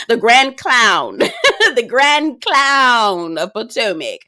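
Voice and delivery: slow, loud, laughing nickname; laughing mockery. Real, not acted. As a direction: crown her the clown, laughing. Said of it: "mocking nickname".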